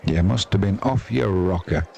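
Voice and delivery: ordinary talk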